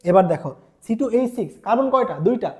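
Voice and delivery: normal talking voice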